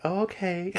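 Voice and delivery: high, small "oh, okay"; imitated taken-aback reply. Retold reaction to instant rejection.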